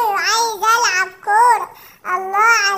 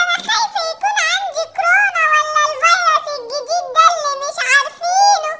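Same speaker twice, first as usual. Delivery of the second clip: put-on whiny little girl; 6 semitones higher than usual. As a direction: play a sniffly little girl complaining. Girl doll teases, then sick.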